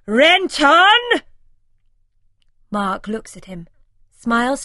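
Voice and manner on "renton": very loud, much higher full-throated scream of a name. A narrator's reading. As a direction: scream across the airport at him. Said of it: "Simon screams at the top of his voice".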